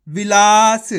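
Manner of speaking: loud "विलास" with a long-held ला; syllable-by-syllable reading. Shown as a demonstration. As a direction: model "विलास" for learners, stretching ला. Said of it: "इस तरीके से एक ही अक्षर का उच्चारण".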